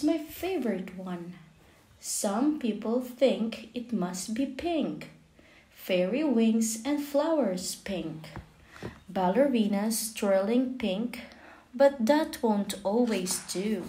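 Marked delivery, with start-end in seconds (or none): none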